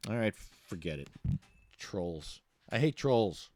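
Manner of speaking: usual speaking voice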